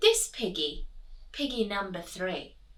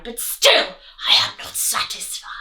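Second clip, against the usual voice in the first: greedy wolf; 8 dB louder than usual. Acted. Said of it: "Wolf cried".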